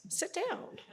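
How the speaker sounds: sit down sung up high with a lift at the end; playful command. Shown as a demonstration. Father showing his daughter a short command, light and lilting. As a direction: toss it off lightly with a rising lilt. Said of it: "I could have just went".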